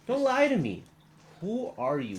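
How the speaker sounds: angry shout. Acted. Orc leader confronting lying envoys.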